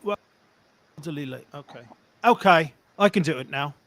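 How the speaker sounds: ordinary talk, nothing marked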